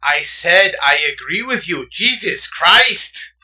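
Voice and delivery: drawn-out fed-up shout; exasperated outburst. Real, not acted. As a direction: stretch it out in exasperation. Exasperated over repeated argument.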